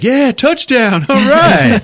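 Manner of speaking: high, loud, slow celebratory shout with a laugh; joyful yell. Retold or imitated. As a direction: get swept up in the excitement. Greg caught up in enthusiasm.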